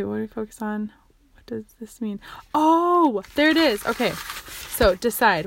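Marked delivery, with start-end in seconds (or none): 1.3-2.2 whispering the question to herself; hushed, reading to herself; whispered reading. Real, not acted. Reading a notebook page to herself. 2.5-3.9 higher, louder sudden discovery; spotting what you were looking for; a little burst of found it; pleased surprise. Real, not acted. Finds the spot in her notes.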